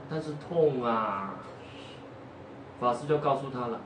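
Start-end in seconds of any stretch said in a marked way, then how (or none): 0.1-1.3 slow, low pained moan. Acted. Sick monk answers visitor, slow pained moan. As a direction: drag the words out like it hurts.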